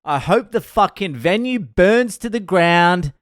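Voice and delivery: loud, high, drawn-out mock tirade; angry yelling. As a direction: act out a furious walk-out rant, stretching the words. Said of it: "he's yelling at the bar manager".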